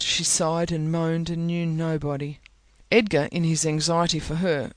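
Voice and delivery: usual speaking voice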